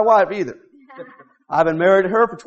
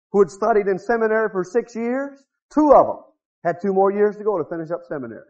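usual speaking voice

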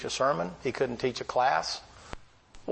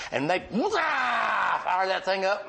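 engine roar; 7 semitones higher, much slower than usual. Retold or imitated. Imitating engine firing up.

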